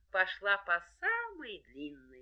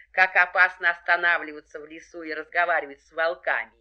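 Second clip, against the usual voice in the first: plain reading voice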